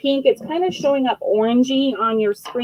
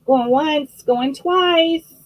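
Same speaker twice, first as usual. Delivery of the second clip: auctioneer call; 5 semitones higher, 4 dB louder, slower than usual. Real, not acted. Auctioneer closing the sale.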